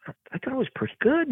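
high, puzzled protest rising at the end; retold surprise. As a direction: protest in a high voice, taken aback. Retells his surprise at being corrected.

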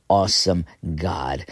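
normal talking voice

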